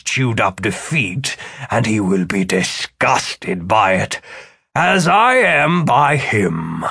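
intense, relishing vow of revenge; vengeful Philip. A narrator's reading. Philip tells Martin how he'll beat him, vengeful. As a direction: savour the coming defeat, stretching the last words.

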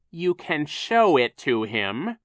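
slow, loud, mounting irritation; rising anger. A narrator's reading. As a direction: let anger climb through each word. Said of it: "his voice rising in anger".

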